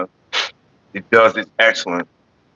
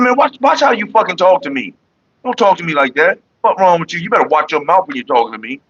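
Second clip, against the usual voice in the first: higher pitched heated scolding; retold telling off; 7 semitones higher than usual. Warning the woman to watch her mouth, heated and high. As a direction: lecture her firmly, voice raised in pitch. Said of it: "I said".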